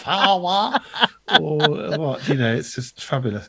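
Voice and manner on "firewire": high, laughing "fah-wah" for "firewire"; father-in-law impression. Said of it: "got a very particular voice".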